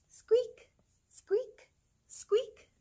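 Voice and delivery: very high tiny squeaks; very high mousy squeak voice; mouse squeaks. Pretend. Mouse sounds in farm book.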